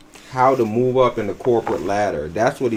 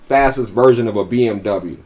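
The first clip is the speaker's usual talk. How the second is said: ordinary talk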